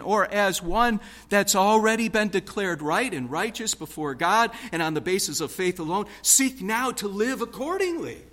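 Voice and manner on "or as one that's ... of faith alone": normal talking voice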